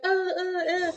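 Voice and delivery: soft, high-pitched mock moans; imitated moaning. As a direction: mimic the movie woman's moans, high and quiet. Imitates woman's moans in film.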